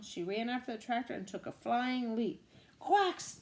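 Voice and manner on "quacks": high loud quack; duck quack yell. Acted. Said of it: "yelled Duck".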